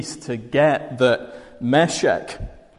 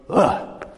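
a heavy, drawn-out "Ugh" groan; emphatic groan; 5 semitones higher than usual. Shown as a demonstration. Demonstrating the Hebrew woe as a heavy groan. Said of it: "It's more like woe".

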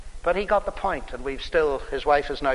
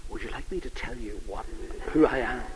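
much softer, secretive hush; whispering; 5 semitones lower, 5 dB quieter than usual. Retold or imitated. In a restaurant corner, whispering to the wife. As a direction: keep it low and conspiratorial. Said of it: "we're now all talking in whispers".

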